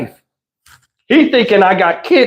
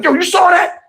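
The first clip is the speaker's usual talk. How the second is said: high, excited call; hyped; 9 semitones higher than usual. Retold or imitated. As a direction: kid showing off his throw. Hyped kid.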